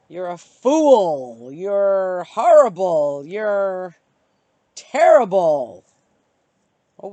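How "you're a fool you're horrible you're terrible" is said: loud, slow mock scolding; hammed-up harsh critic, louder and slower; imagined insults. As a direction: spit out each insult slowly and loud. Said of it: "what if they say".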